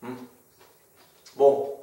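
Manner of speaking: humming while eating; chewing. Real, not acted. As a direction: mouth partly full. Seen 0: Spoon went to mouth at -2; jaw chewing, lips closed through words.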